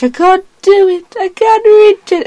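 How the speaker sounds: high, loud, strained cry of effort; straining Doctor. Retold or imitated. Child acting the Doctor struggling outside the airlock. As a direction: reach and fail, voice cracking up high. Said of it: "he's like".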